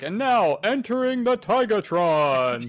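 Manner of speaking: grand mock announcement, stretched out; hyped-up intro. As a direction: draw it out like announcing a fighter's entrance. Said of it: "I want a hyped up intro".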